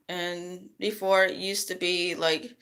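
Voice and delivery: ordinary talk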